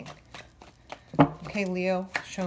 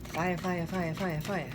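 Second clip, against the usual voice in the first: Beavis. Retold or imitated. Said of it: "Is that Beavis and Butthead?".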